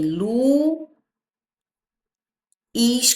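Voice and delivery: "lu" drawn out, long pause, then "is"; split syllables. Shown as a demonstration. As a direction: pull "lu" and "is" apart as two slow syllables. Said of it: "o som distinto entre os dois".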